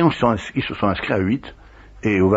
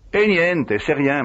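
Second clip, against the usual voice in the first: evasive; 7 semitones higher, slower than usual. Retold or imitated. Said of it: "d'un ton évasif".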